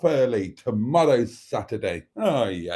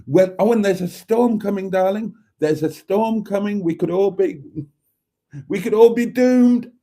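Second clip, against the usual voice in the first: mock alarm; 6 semitones higher, 4 dB louder than usual. Mock alarm about a storm.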